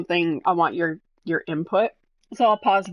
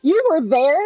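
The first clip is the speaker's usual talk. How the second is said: surprised; 7 semitones higher, 7 dB louder than usual. Retold or imitated. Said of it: "And I was like".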